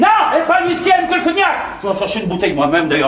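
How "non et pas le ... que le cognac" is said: shrieked, very high objection; exasperated refusal. Acted. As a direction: squawk your refusal at the top of your range. Rejette le whisky, excédé. (refus excédé, criard et aigu)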